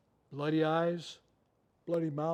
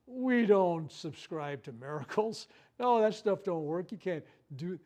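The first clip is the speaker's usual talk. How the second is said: mocking the doctor; 3 semitones higher, 7 dB louder, slower than usual. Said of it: "Kaiser goes".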